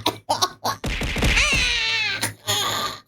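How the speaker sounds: high shrieking laugh. Real, not acted. Loud laugh at the effect.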